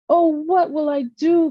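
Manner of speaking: put-on high, tearful little girl; a child near tears; crying. A narrator's reading. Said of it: "as she continued to cry".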